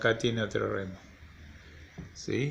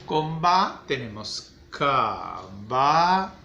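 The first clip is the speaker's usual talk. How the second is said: slow reading aloud; 4 dB louder, much slower than usual. Shown as a demonstration. Teacher reading a word to the kids, very slow and clear. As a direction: stretch "caballo" out very slowly for the kids. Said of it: "Ahí la leemos".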